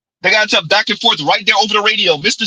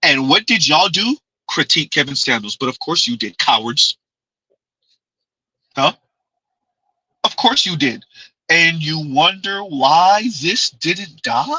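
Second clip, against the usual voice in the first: ordinary delivery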